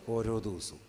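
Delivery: low, soft and falling; ściszone. Shown as a demonstration. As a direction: say it softly, dropping in pitch. Preacher quotes prayer line softly first.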